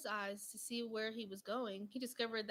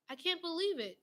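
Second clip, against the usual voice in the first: high, louder gasp of disbelief; 7 semitones higher, 5 dB louder than usual. A narrator's reading. Arnie gasping at the baker's answer, stunned. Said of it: "Arnie gasped".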